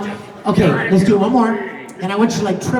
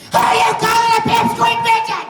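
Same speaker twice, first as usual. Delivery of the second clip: full furious shout. Shown as a demonstration. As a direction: scream it at full force. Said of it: "Here is your reward".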